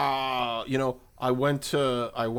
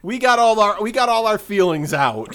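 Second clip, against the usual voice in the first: mock relief; 9 semitones higher, 7 dB louder than usual. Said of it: "they're like".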